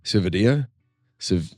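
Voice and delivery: ordinary talk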